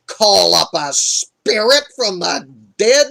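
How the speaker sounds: loud, disbelieving question rising at the end; incredulous echo. Retold or imitated. Witch repeating Saul's request back at him, incredulous. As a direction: throw the request back, appalled.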